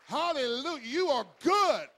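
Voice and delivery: loud, high, stretched-out praise shouts; rally the church with big ringing cries; preaching at full force. Acted. Pastor shouting praise to the moms in church.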